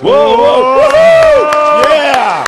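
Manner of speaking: very high, loud whooping; excited cheer. Real, not acted. Commentator cheering the robot's run, excited shout. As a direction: whoop it out at full volume. Crowd moment, robot reaches target.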